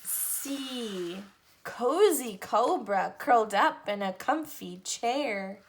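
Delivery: lively picture-book read-aloud, each letter set apart; reading to children. Acted. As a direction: read an alphabet book to little kids. Reads alphabet book to children.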